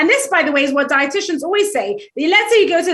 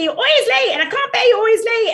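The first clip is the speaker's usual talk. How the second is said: higher, exasperated nagging at the husband; critical complaint; 8 semitones higher than usual. Retold or imitated. Wife nagging her late husband, exasperated criticism. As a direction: scold him with no curiosity at all. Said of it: "there's only criticism".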